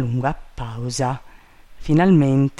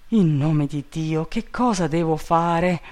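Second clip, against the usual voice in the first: usual speaking voice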